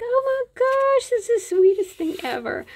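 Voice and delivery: very high, melting gush; touched gushing. Retold or imitated. Mother retelling her reaction to son's pride, touched gushing.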